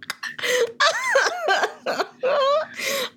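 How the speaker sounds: long, high burst of laughter; helpless laughter. Real, not acted. Friend confesses cartoon crush, helpless shrieking laughter. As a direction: crack up completely, drawn out.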